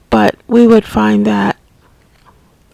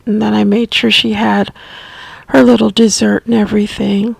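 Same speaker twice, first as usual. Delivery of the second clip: normal talking voice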